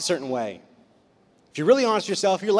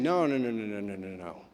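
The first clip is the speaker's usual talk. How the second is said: quiet, low run of repeated no's, fading away; string the no's together softly, sinking lower; gentle refusal; 10 semitones lower, 10 dB quieter than usual. Acted. Preacher voicing what scripture says here, soft gentle correction.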